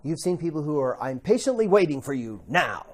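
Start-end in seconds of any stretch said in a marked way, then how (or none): 1.0-2.8 passive-aggressive. Retold or imitated. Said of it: "that's not really kind".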